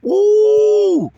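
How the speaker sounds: sudden high falsetto ooh; a high excited preacher's ooh. Real, not acted. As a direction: whoop it up high. Preacher's exclamation mid-sermon.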